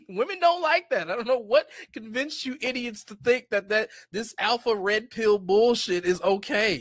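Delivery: high-pitched, emphatic exasperation; heated insistence. Real, not acted. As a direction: scold them with rising indignation. Heated rebuke of red-pill men.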